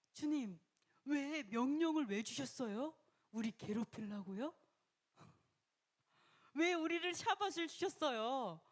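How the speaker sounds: high, loud complaining to God; whining complaint. Acted. People grumbling at God, high loud whine.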